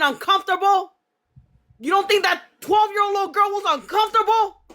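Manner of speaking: building from angry to high-pitched furious; rising outrage. Real, not acted. Confronting mother over abuse.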